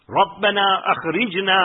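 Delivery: quick recited Arabic verse; fast Quran quote; Quran recitation. Acted. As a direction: rattle off the verse.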